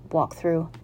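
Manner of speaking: usual speaking voice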